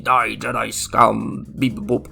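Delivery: slow, loud droid voice; robot voice. Acted. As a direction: drag out each word like a battle droid. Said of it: "droid mówi". (głos robota)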